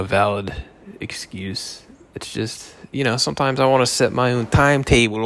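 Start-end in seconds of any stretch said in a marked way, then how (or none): 1.0-2.7 whispered. Real, not acted. Trailing whisper.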